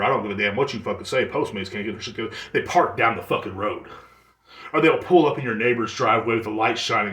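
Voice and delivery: ordinary talk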